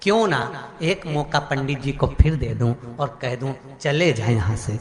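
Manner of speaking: low, lightly voiced lion musing inwardly; lion thinking to himself. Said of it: "शेर ने मन में सोचा".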